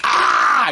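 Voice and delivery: loud mock growl of exasperation; imitated kid's frustrated outburst; frustrated yell. Son storming out of the room, mock frustrated yell.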